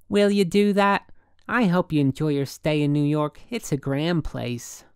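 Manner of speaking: high, loud opening settling into polite farewell; affected city woman, gracious. A narrator's reading. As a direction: sign off with sociable airs.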